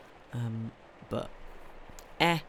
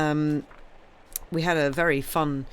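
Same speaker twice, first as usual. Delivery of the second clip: normal talking voice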